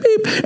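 high-pitched electronic beeps; imitated scanner beep. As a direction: mimic a card reader beeping.

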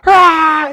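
huge high, loud bellowing roar; imitating a man's animal roar; wild animal roar. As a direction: let out the roar of a wild beast. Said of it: "he roared like a wild animal".

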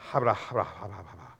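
low gibberish mumble trailing away; nervous mumbling. Acted. [name] to himself walking into the hungry crowd, nervous mumble. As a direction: mumble nonsense under your breath, fading out. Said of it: "thinking as I'm walking in the crowd going".

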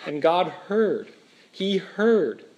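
ordinary delivery

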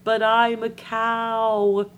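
slow, loud, drawn-out punchline; mystery phone voice. Acted. Talking cow answering the woman on the phone, slow drawl. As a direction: stretch it out long and mournful like a moo. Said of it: "the voice goes".

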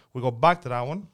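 ordinary talk

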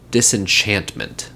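ordinary delivery

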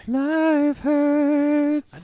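high, drawn-out put-on voice on "Life hurts"; mock dramatic voice. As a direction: stretch it out like a melodramatic actor. Third echo in put-on voice.

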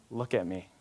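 low, soft and tender; loving dad. Acted. Dad kneeling at his son's eye level, loving. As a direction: gently get a child's attention. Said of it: "get something across to me in love".

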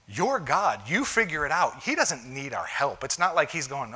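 ordinary talk

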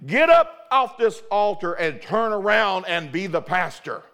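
loud, commanding declaration; the Lord commanding the pastor to rise. Retold or imitated. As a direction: thunder it like God's command. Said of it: "the Lord just spoke at the altar and said".

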